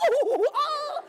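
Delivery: high-pitched laugh breaking out; amused laugh; high burst of laughter. Real, not acted. Laughing at frogs in beds.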